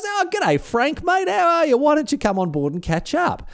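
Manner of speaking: high, friendly hail across the deck; a mock cheery yell, pitched very high; friendly call out. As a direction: call out to an old mate, way up high. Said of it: "he yells out to me".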